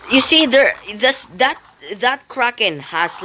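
normal talking voice

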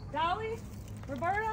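high, soft questioning name calls; calling hens. Real, not acted.

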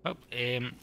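ordinary delivery

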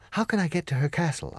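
put-on a child checking for reassurance; lightly voiced hopeful girl; girl's voice. Dorothy asks.

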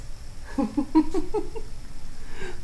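high breathy laugh; light high giggle; airy giggle. Real, not acted. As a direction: giggle at the cats. Laughing at cats.

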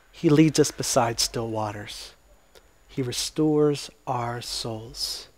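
tender reading of the shepherd psalm; gentle psalm. Acted. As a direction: ease into it like comfort.

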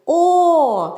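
О drawn out long on the voice; протяжний звук О. Shown as a demonstration. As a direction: sing out a long, held О. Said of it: "ми співаємо за допомогою голосу". (протяжне, наспівне «О»)

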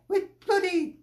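ordinary delivery

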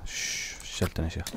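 hushed whisper, very soft. Real, not acted. Gamer hushing so the cannibals won't hear, whisper. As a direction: hiss it under your breath.

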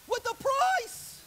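climbing loud and high on the verse; voice rising high. Real, not acted. Preacher climbing to a high cry on the price. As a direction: pitch it up hard to stress the price. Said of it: "My voice changed there".

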